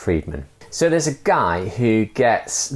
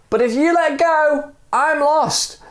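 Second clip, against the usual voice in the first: high, urgent plea; pleading reply. Acted. Stranger replying from midair, high pleading voice. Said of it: "the stranger replied".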